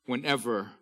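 loud, high, drawn-out preaching that fades on the last words; booming psalm reading over music; fervent scripture reading. Acted. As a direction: declaim the verses with full force.

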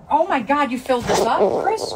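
high, startled outburst; real dismay at icy water; shocked at the barrel. Real, not acted. Sees the ice-filled barrel.

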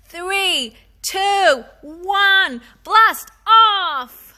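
excited countdown. Acted. Daniel launching his box rocket, building excited countdown. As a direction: build to a shout on blast off.

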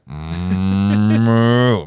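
loud, long mooing call; toy cow moo. Retold or imitated. Teller imitating the cow can in the meeting, loud moo.

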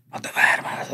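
whispered, as if heard from far off; a voice fading into the distance; faint faraway voice. Retold or imitated. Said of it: "La voz de mi tío yo la oía bien lejos".

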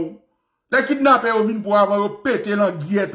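ordinary delivery

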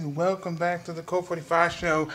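normal talking voice

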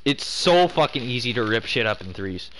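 normal talking voice

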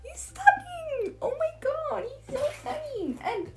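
swooning over the horse in a high squeal; squealing gush. Real, not acted. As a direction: squeal it like a thrilled fan.

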